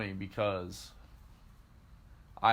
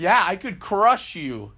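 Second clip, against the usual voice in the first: loud, high, drawn-out mock boast; 8 semitones higher, 10 dB louder, slower than usual. Big mover answers the tiny old lady, loud mock boast. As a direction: boom it out slowly, playing the giant.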